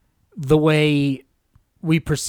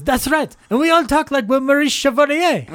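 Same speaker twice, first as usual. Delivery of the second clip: much higher, hammed-up French accent; mock French accent; 12 semitones higher than usual. Guy in the film mocking French talk, high French accent. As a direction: squeal it in a silly French accent. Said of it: "one of the guys gets up and goes".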